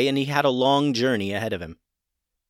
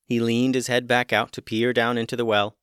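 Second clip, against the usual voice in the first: usual speaking voice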